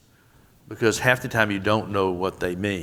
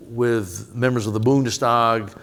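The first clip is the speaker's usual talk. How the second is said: ordinary delivery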